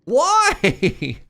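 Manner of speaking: high, loud outcry; loud, high-pitched wail of disbelief; exclaimed protest. Real, not acted. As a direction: cry it out in protest at the price.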